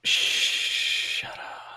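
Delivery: unvoiced shushing whisper; hushing. Real, not acted. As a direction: hush them, whispered. GM hushes joking player.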